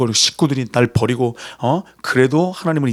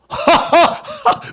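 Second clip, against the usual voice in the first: bursting high-pitched laugh; 3 dB louder than usual. Real, not acted. Preacher laughing over the name meaning laughter, high laugh.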